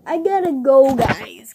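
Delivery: loud cheerful exit; loud sign-off. Real, not acted. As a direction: call out the goodbye.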